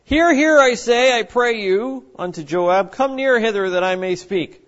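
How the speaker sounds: calling out loudly from the wall; a woman's loud call from the city wall; wise woman crying out. Acted. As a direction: call out to be heard over the wall. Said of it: "It says, "Then cried a wise woman".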